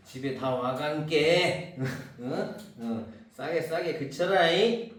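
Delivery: slow, strong Jeolla dialect; mock Jeolla mother hushing a child, drawn out. Teacher showing the stronger Jeolla version of the line, slow. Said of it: "전라도면 이거보다 말이 세죠".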